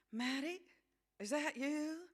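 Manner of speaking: put-on high, tentative questioning in American accent; lightly voiced weary young mother, lifting into the question; tired voice. A narrator's reading. Worn-out mother asking who came in, tired voice. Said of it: "a tired voice asked".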